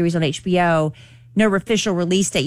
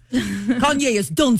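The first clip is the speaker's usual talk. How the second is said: laughing, slow mock Russian accent; hammed-up Russian, slowly; 5 semitones higher than usual. Host giggling through her Russian bit, mock accent. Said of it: "you wanna do your Russian?".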